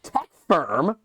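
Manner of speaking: high-pitched, mocking disbelief; worked-up indignant ranting; incredulous rant. Real, not acted. Incredulous rant at character's choice.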